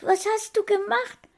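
put-on very high little boy voice; astonished child. A narrator's reading. Small boy asking what his friend did, astonished. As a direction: ask in high-pitched wonder.

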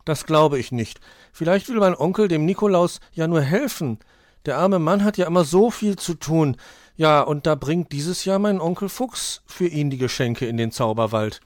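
high, loud little fox voice; Weißohr, defending the uncle. Acted.

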